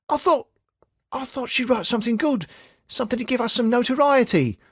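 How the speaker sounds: Tim's voice. A narrator's reading. Tim upset about reporter.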